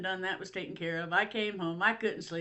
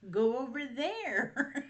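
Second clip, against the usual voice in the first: laughing; 5 semitones higher, slower than usual. Retold or imitated.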